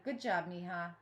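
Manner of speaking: usual speaking voice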